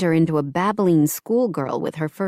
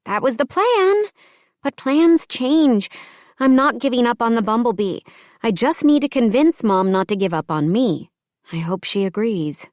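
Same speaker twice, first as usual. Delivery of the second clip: lightly higher, earnest woman's voice; young woman voice; 6 semitones higher, 3 dB louder than usual. Acted. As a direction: voice the friend, hopeful. Paige's dialogue in the story.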